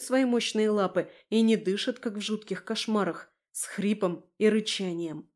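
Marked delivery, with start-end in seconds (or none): none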